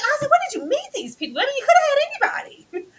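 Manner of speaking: high-pitched, laughing incredulity; appalled disbelief. Retold or imitated. Podcaster asking [name] where he met these people, appalled. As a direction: plead as if comically appalled.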